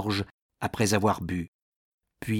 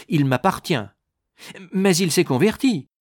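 heated squabbling; 8 semitones higher, 6 dB louder than usual. A narrator's reading. Clergymen quarrel over dying man.

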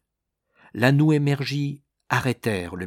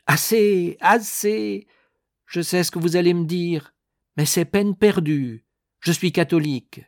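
high, loud, impatient interruption; fed-up, raised-voice protest; exasperated outburst; 8 semitones higher, 5 dB louder than usual. A narrator's reading. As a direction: cut the preacher off, loud and high. Dying man cuts off preaching minister.

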